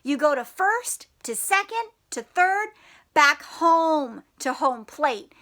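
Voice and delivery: usual speaking voice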